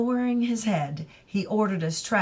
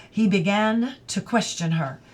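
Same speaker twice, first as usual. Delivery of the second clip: ordinary delivery, nothing marked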